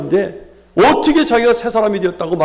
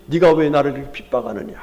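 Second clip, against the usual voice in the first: a lower, measured voice of Jesus asking why; Jesus rebuking; 7 semitones lower, faster than usual. Acted. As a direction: ask it low, as Jesus confronting Saul. (사울을 꾸짖는 예수님의 낮은 목소리)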